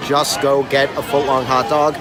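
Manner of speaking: normal talking voice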